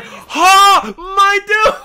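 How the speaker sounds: high, loud yelp dissolving into laughter; real amused outcry, very high; shocked shout. Real, not acted. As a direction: shout it, then crack up. Shocked by revealed private account.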